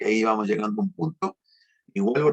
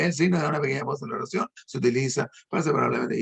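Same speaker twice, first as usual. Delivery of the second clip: rushed reading without pauses; 2 semitones higher, faster than usual. Shown as a demonstration. Teacher showing a reader ignoring punctuation, rushed and run-on. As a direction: run "da una pequeña pausa en la oración" quickly straight into "se utiliza". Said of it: "se larga de un solo".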